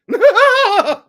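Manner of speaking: very high, loud cackling laugh; Tucker Carlson laugh. Retold or imitated. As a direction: burst into a shrill mock cackle. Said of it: "my Tucker Carlson laugh".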